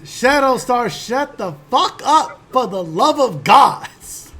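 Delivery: loud, slow yell across a tavern; yelling across the room. Acted. Stranger yelling at Shadowstar to shut up, loud slow bellow. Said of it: "somebody yell out across everything".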